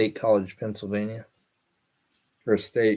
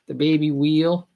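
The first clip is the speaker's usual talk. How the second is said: put-on whale said as "wheel" in a Boston accent, a bit higher; mock Boston accent; 5 semitones higher, 4 dB louder than usual. Streamer quoting the whale video guy, mock Boston accent. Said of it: "Boston accent".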